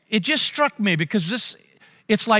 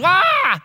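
put-on high, loud yelp of a falling kid; sudden child's cry of alarm; kid's startled yell; 13 semitones higher, 5 dB louder than usual. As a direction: yelp as you topple off the seat. Kid falls off booth.

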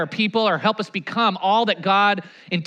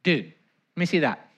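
quiet retold advice; 8 semitones lower, 7 dB quieter than usual. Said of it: "I'm like".

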